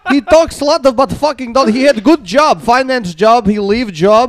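mock Polish grandpa listing faults. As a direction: complain like a disapproving Polish grandpa. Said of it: "is Polish".

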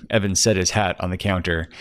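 usual speaking voice

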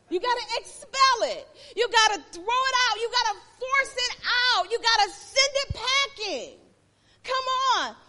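loud, high, driving sermon cry; preacher pushing the congregation hard, loud and high; preaching at full force. Acted.

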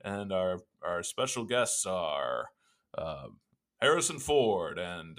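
slow, loud announcer-style show intro; podcast intro. Acted. Host welcoming listeners to the podcast, announcer voice. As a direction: open the show like a radio host.

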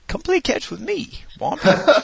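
high, playful ad-line quote; imitating an old TV commercial. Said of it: "You'll never live that commercial down".

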